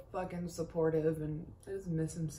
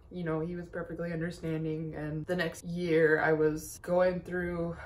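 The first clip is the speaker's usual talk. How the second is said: normal talking voice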